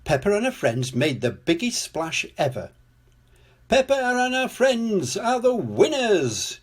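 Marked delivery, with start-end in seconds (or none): 3.7-6.6 high, slow winner declaration; declaring. A narrator's reading. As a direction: proclaim it grandly like a contest host. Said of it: "declared Mister Potato".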